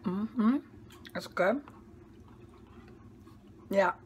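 chewing while talking; muffled mumbling with food; mouth full. Real, not acted. Seen 1: takes forkful at -2, closed-mouth chewing through 3.9.

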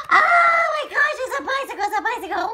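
high, loud, laughing cry of joy; thrilled shout. Acted. George unwrapping his new bicycle, overjoyed shouting. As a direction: shriek with delight over the gift.

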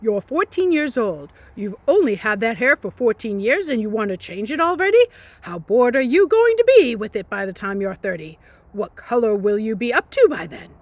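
loud, exasperated fatherly rant; ballistic dad. Retold or imitated. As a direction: rage at her like a fed-up dad. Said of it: "Dad went ballistic".

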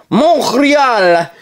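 slow, put-on French accent reading the team names. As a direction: lay on a mock French accent, slowly. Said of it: "chirp my, uh, French accent".

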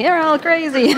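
put-on high, loud Italian-accented exasperated cry; Italian family exasperation. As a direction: throw up your hands like an Italian mother. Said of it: "Sounds like from an Italian family".

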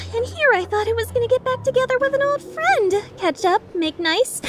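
high-pitched theatrical complaint; hammed-up wounded disappointment; mock lament. Theatrical complaint at old friend's refusal.

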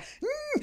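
high strained hum; straining grunt. Retold or imitated. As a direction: push with a tight hum. Straining to push.

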